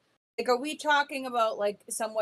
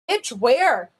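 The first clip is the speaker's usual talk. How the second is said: loud, exasperated retort; indignant outburst; 10 dB louder than usual. Retold or imitated. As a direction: blurt it out, fed up. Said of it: "I'm like".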